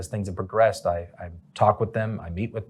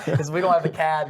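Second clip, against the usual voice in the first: higher-pitched, real laughing speech; 8 semitones higher than usual. Real, not acted. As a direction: start the explanation through a laugh. Laughing over needing a cad.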